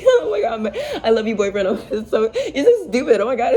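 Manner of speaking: high, loud, laughing mock of a giggly girlfriend.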